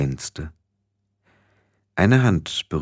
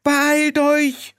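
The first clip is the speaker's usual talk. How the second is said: very high shrieked summons; frantic falsetto calling; urgent call. A narrator's reading. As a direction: call down in a high-pitched panic. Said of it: "rief Ginny ins Loch".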